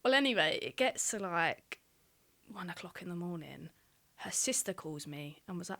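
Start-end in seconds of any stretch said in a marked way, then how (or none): none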